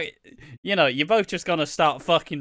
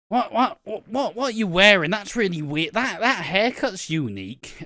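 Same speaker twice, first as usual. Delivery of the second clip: mock uncle; 6 semitones higher than usual. Mocking uncle at Christmas table.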